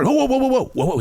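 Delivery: high, laughing mock alarm, then dropping away; imitating the expert hitting the brakes, laughing; laughing whoa. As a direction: rein someone in while cracking up. Expert voiced stopping him.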